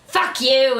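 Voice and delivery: hammed-up angry outburst; mock abuse. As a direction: imitate the twin lashing out. Acting out the bitchy twin's abuse.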